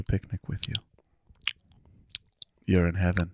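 soft unvoiced whisper; ASMR whisper. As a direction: keep the joke ASMR hush. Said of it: "going all full A S M R here".

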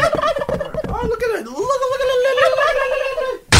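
very high, far-off cooing voice; cooing at the turkey. Real, not acted. A man cooing at a wild turkey, very high and delighted. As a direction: call out to the bird in a sky-high coo.

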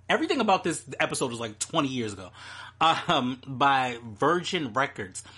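ordinary delivery

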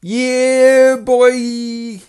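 loud, high drawn-out hype-man cry; high-pitched hype-man holler. Acted. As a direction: belt it out high and long. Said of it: "that was my [name] impression".

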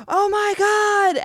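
high, slow, frightened gasp of oh my God; retold terror. Driver on a 911 call seeing the Dog Man, terrified.

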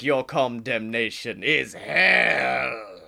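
low, slow verdict from the devil; Satan voice. Retold or imitated. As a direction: pronounce the doom slowly and darkly. Satan pronounces the sentence.

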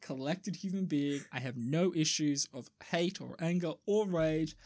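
feigned serenity, quiet and controlled; mock calm. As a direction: insist softly that you're perfectly composed. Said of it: "I am a calm, collected human being".

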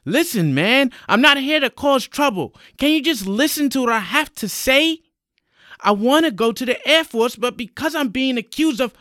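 high, loud, insistent appeal; urgent pleading. Acted. Young man with hands up explaining to policeman, urgent high plea. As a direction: beg to be heard over the danger.

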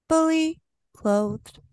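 drawn-out comic aside; slow deadpan tag. Acted. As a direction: say it slow and dry. Podcast intro punchline.

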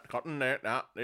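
lightly mimicked cutting Northern Irish accent; high Northern Irish accent. Shown as a demonstration. Showing how Derry and Kilkeel sound, cutting Northern Irish accent. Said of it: "it gets really up there".

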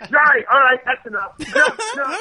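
high-pitched mock exasperation; retold anger. Actor re-enacts the star halting the audition, mock mad. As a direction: imitate someone fed up, shutting it down fast. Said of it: "he just got so mad".

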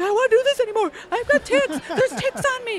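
high, loud mock panic; freaking out. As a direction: whine it like a man covered in ticks. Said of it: "he's freaking out".

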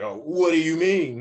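lightly hammed-up indignation; affronted protest. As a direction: imitate a man acting wrongly accused. Confronted cougher protests.

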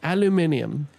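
Aluminium as "al-yoo-MIN-ee-um"; British aluminium. Shown as a demonstration. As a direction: give Aluminium all five British syllables. Teasing example of regional pronunciation.

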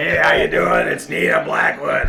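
imitating a smoky, raspy radio voice; mock a worn-out DJ intro; Nina Blackwood voice. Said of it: "the blonde gal with the smoky voice".

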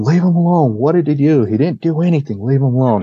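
high-pitched mock yell, easing down; retold yelling. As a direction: imitate friends pleading in protest without full volume. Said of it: "My friends are yelling".

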